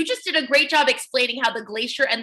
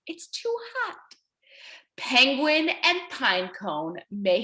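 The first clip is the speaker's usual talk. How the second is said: story narration; 3 semitones lower, slower than usual. A narrator's reading. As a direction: read it slowly and tenderly to little kids. Teacher reads picture book aloud.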